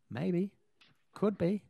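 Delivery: normal talking voice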